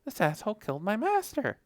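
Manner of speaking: shocked accusation. Retold or imitated. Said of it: "He's like".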